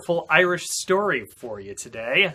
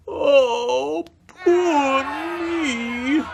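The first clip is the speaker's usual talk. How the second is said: much higher, loud hammed-up crying wail; 12 semitones higher, 5 dB louder, much slower than usual. Jamie lamenting no food, loud mock-crying wail. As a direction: wail in self-pity. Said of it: "wailed Jamie".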